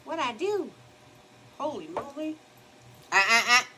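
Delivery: high, startled exclamations; alarmed high holy moly; dismayed. Real, not acted. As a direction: react to a makeup slip. Dismayed at her makeup mistake.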